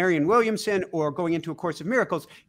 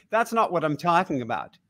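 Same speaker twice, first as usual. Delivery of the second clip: normal talking voice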